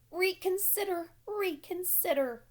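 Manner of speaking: high, slow, drawn-out goose plea; goose honking. A narrator's reading. Goose pleading with Wilbur, high and slow. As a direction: stretch it long and high. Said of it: "honked the goose".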